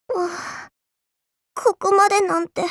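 weak defeated groan. Acted. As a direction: gasp it out, beaten. Defeated in battle.

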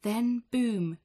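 loud, high narrator burst; dramatic boom. A narrator's reading. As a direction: hit it like a door crashing. Giant bursts through the door.

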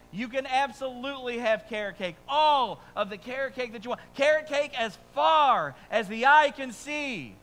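father answering son with a big mock-generous promise; grand fatherly answer. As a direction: promise it all grandly, then the catch. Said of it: "what answer I gave him".

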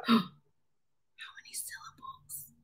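gasp, then a whispered question; hushed gasped question. Acted. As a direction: whisper it like a secret.

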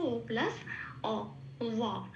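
ওয়া slowly with open lips, lips never closing; open-lipped wa. Shown as a demonstration. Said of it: "একদম ঠোঁট খুলে উচ্চারণ হবে ওয়া". (ঠোঁট খুলে ওয়া উচ্চারণ)

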